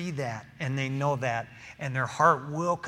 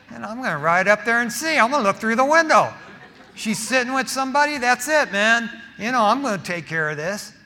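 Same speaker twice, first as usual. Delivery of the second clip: louder, higher, fast jealous rant; jealous bluster; 6 semitones higher, 7 dB louder than usual. Retold or imitated. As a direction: rush it out like a suspicious husband fuming. Retold jealous plan to spy.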